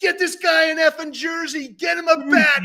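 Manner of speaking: high, loud mock shout barking orders; imitating shouted orders. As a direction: yell across the store for a jersey. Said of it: "he's like".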